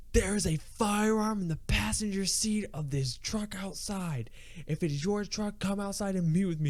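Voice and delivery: high, projected officer voice; cop's announcement. Retold or imitated. Cop addressing the Best Buy about a firearm, announcing. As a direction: call it out to the whole store, high-pitched.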